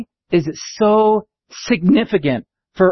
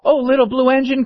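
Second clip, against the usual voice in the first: high pleading toy voice; beseeching dolls and toys; crying out; 7 semitones higher than usual. Acted. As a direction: cry out to the engine, higher. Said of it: "cried the dolls and the toys".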